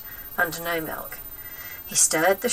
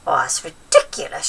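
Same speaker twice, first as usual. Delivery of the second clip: scornful granny; 4 semitones lower, 4 dB louder than usual. Acted. Grandma voiced in story.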